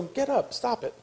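soft, high-pitched dismissive scolding; hushed scolding. Acted. Wife to her panicking husband, hushed scolding. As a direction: quietly tell him off.

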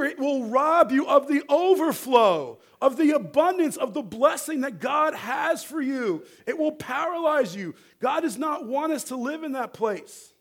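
high, loud pulpit exhortation; forceful preaching. Real, not acted. Preacher urges the congregation out of fear, forceful preaching. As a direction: proclaim it to the whole congregation.

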